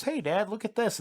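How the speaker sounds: put-on high little-boy voice. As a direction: pitch it up like a kid calling Dad. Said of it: "The little boy, he goes".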